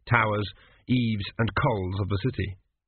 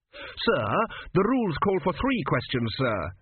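higher, tense polite protest; protesting student; 6 semitones higher than usual. Acted. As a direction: protest respectfully but firmly. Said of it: "Tepic protested".

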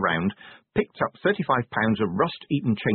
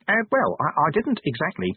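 higher, halting inspector voice; flustered hesitation; 7 semitones higher than usual. A narrator's reading. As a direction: stammer a timid protest. Flustered inspector handed weapons.

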